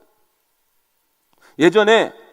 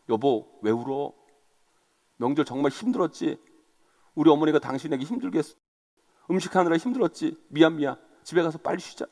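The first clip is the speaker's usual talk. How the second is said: soft, lowered consoling voice; playing a tender, apologetic husband; acted soothing husband; 3 semitones lower, 6 dB quieter than usual. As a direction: gently comfort your crying wife, unhurried at first. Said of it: "위로해주고 달래주고".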